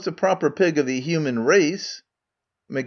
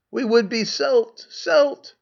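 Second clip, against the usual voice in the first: put-on higher, piping little girls' voices; squeaky young daughters; 9 semitones higher, slower than usual. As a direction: pipe up like two small girls begging. Daughters answer their father.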